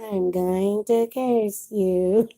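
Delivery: slow, soft, playful witchy threat; mock witch curse. Vlogger teasing her viewers with a slow mock curse. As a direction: drag out the curse like a storybook witch.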